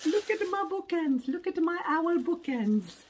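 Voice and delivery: high, loud mock British owl voice; put-on British voice. Unboxing owl bookends, talking in a silly high British accent. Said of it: "I don't know why I'm talking this way".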